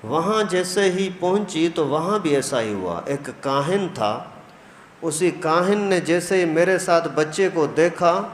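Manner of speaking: ordinary talk, nothing marked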